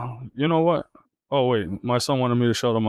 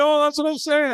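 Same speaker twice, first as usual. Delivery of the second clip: very high, emphatic agreement; excited agreement; 13 semitones higher, 3 dB louder than usual. Real, not acted. Storyteller to co-host who got his worry, excited agreement. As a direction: jump on the point, voice shooting up.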